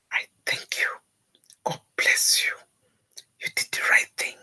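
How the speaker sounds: whispered thanks, near crying; tearful whisper. Retold or imitated. Bride thanking her friend through tears, whispered.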